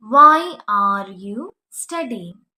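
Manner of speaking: drawn out very slowly, loud start; slow model sentence. Shown as a demonstration. Teacher modelling the question for students, very slow. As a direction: stretch every word for the class. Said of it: "are use ചെയ്യണം".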